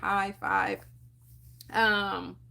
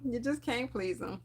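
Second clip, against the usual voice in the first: quieter, resigned repeat of "You just can't please 'em"; softer echo; 8 dB quieter than usual. Retold or imitated. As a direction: let it fall away, resigned. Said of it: "I'm just like".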